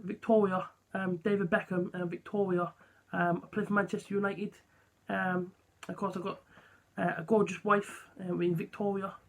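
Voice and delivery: soft Essex voice, quiet and hesitant; softly spoken Essex footballer; David Beckham impression. YouTuber imitating a footballer introducing his wife, soft Essex impression. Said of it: "So when he talks, like".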